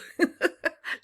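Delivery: high, teasing pack laughter; mocking laugh. Wolves laughing at the small pup, high teasing laugh. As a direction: laugh down at the little one. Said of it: "laughed the wolves".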